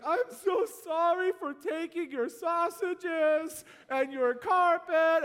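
high, slow, broken-up mock weeping; weeping apology. Repentant thief apologising to Marius, imitated weeping. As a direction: plead through tears. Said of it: "the guy started to weep".

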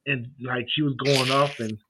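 normal talking voice

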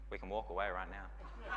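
a low, soft murmur leaned in close; hushed aside. Retold or imitated. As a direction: quietly offer an escape, deadpan. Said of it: "just leans over to me and goes".

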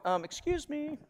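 high, soft and meek; a shy voice before God; timid Moses. Retold or imitated. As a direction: politely raise a small hand. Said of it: "Moses is going".

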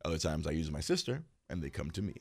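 low, quiet mock sinister assassin; quoting the assassin. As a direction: murmur it like a shady hired killer. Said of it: "the assassin was like".

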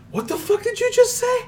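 high, squeaky mock outrage; hammed-up high outraged voice; incredulous frisbee golfer. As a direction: squeak it like an offended goofball.

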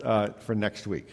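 ordinary talk, nothing marked

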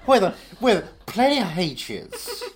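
slow, drawn-out southern drawl; southern accent. Pretend. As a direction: lay the southern charm on slowly. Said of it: "in this accent".

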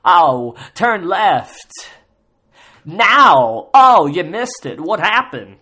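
very loud, high hammed-up GPS impression; mock GPS voice. Parodying a hack's GPS celebrity voice, loud and hammed-up. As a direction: blast it like a cheesy GPS bit. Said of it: "They say some bullshit of like".

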